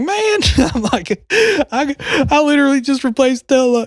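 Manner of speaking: high, laughing exasperation at the officer; exasperated disbelief. Retold or imitated. Telling the officer he just fixed the light, high exasperation. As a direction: throw it up high like you can't believe it.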